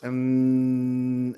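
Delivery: long hummed "Mm" as an N, tongue on the alveolar ridge; sustained N sound for "Mm", tongue tip touching the alveolar ridge. Shown as a demonstration. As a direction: hold "Mm" as a steady N behind the upper teeth. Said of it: "tu lengua cómo choca con los alvéolos".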